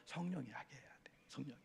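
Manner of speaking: soft whispered point. Real, not acted. Pastor urging the congregation, whispered. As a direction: drop to a whisper to drive it home.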